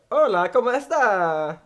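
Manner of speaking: hammed-up Mexican accent. Shown as a demonstration. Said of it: "show them your, uh, Mexican accent that you perfected".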